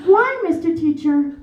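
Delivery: rising, pleading why, lightly acted; innocent child plea. Quoting the nanny's four-year-old self, mock innocent plea. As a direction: plead like a wide-eyed little girl. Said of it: "nobody talks like that".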